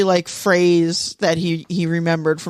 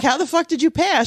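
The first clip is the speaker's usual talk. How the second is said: incredulous; 9 semitones higher than usual. Retold or imitated. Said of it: "And I'm like".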